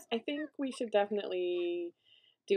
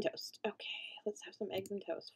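whispered to self; 11 dB quieter than usual. Real, not acted. Choosing food, whispered.

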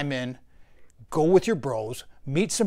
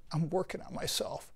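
much softer, mocking whine; softly sneered self-help cliché; mocking whiny quote; 9 dB quieter than usual. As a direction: parrot the excuse with scorn. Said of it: "that I hear".

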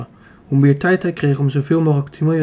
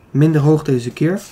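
ordinary delivery

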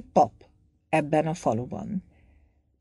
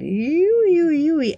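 much higher, louder mock squeal of a startled girl; mock girlish squeal; 12 semitones higher, 5 dB louder, much slower than usual. Priest mimicking a hugged girl's squeal. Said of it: "ennyit tud csak mondani".

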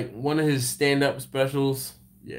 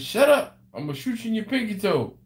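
imitating Eddie Murphy; 7 semitones higher, faster than usual. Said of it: "Eddie Murphy says".

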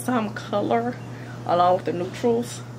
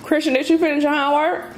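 calling out; 7 semitones higher than usual. Real, not acted. Calling child from another room.

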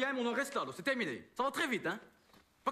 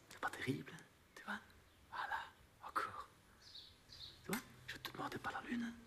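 whispered, much softer; hushed whisper; 12 dB quieter than usual. Acted. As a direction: murmur close to the model's ear. Photographe murmure pendant la séance. (chuchoté tout bas au modèle)

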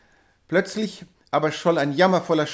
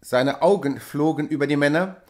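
ordinary delivery, nothing marked

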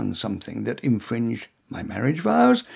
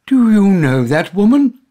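loud, higher, venomous demand; loud, venomous accusation; 7 semitones higher, 12 dB louder than usual. A narrator's reading. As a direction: spit out the accusation at full volume. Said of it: "Quite loud and suitably venomous".

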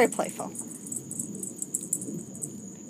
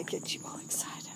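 soft whisper to the cat; whispered pet talk; 12 dB quieter, faster than usual. Real, not acted. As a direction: breathe it as a secret to the cat. Teasing the cat, then whispering.

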